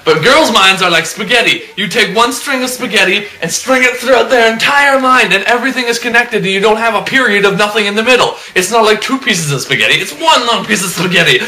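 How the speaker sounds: high-pitched, animated explaining; worked-up rant. Acted. Man explaining how girls' minds work, worked up. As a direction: insist excitedly, pitch riding high.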